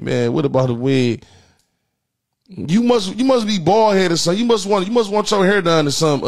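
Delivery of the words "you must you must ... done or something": mock taunt. Mocking Miss Williams, higher.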